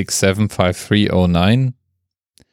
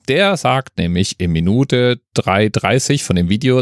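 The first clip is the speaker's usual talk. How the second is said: usual speaking voice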